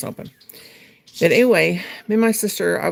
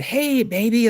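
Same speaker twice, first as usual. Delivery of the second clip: lightly imitated teenage catcaller, pitched up; mock catcall; 6 semitones higher, slower than usual. Teenage boy calling to girls in a parking lot, mock catcall. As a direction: call out a higher "hey, baby" as a cheeky boy. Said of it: "one of them said".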